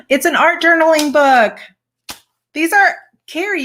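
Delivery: gasp, then high, loud delight; light up at a surprise gift; real thrilled gasp and gush. Real, not acted. Opening a thoughtful gift.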